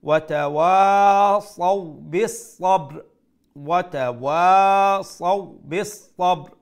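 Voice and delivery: loud slow chant, long wa, সবর closed on b and r; stopped ending recitation. Shown as a demonstration. Teacher modelling the stop, loud chant ending in clipped-vowel সবর. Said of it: "জজম অবস্থায় আমরা পড়ব".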